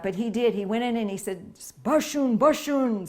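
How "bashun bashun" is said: louder, higher call for everyone to sit; sit-down command shouted. Retold or imitated. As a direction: bark the order to the room. Stern monk silencing the crowd.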